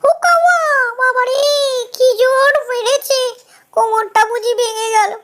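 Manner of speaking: high, loud howl easing into moaning; hammed-up hurt jackal; acted pained wail. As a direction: whimper like a beaten jackal. Beaten jackal howls in pain.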